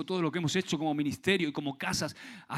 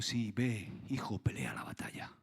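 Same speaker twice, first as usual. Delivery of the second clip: soft fatherly whisper; tender whisper; 5 semitones lower, 5 dB quieter than usual. Acted. God's reply, whispered paraphrase.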